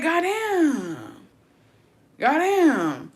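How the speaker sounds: high, drawn-out, dismayed goddamn; stunned goddamn. Retold or imitated. Reacting to the unfollow, high dismayed goddamn. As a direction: let it sink in, stunned. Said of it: "I was like".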